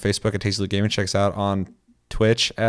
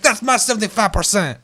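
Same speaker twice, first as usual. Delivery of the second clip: mock French accent; 12 semitones higher than usual. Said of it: "With my perfect accent".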